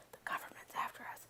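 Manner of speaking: conspiratorial whisper; half-joking paranoid whisper. Pretend. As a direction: hush it like a secret. Conspiracy joke aside.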